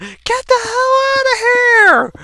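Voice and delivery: high, drawn-out re-enacted shout; yelling. Kid to dad scratching at the door, panicked yell. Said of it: "I would always yell out".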